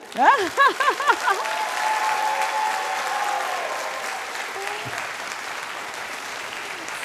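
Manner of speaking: long breathy burst of laughter; big laugh. Real, not acted. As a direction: laugh out loud, high and breathy. Laughing at own joke.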